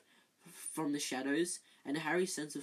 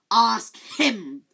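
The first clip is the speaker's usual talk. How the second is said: high, very loud frantic shriek; 7 semitones higher, 15 dB louder than usual. Acted. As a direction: scream the accusation at the boy. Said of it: "shrieked Filch".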